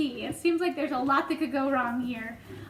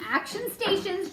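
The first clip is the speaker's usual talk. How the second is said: higher-pitched, mildly raised call; shouted command; 5 semitones higher than usual. A narrator's reading. James rallying the crew to action stations, shouted command. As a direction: call out the order like a captain. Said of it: "James shouted".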